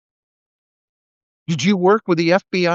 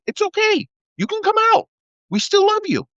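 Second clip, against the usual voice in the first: high, sugary mock-comforting voice; sarcastic gentle reassurance; mock parental reassurance. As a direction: coo at them like a patronizing parent. Mock-comforting closeted men.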